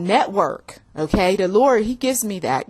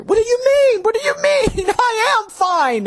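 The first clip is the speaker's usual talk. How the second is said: much higher, indignant squeal of denial; defensive high protest; 14 semitones higher than usual. Acted. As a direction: protest your innocence in a high, offended voice. Defensive reply in acted quarrel.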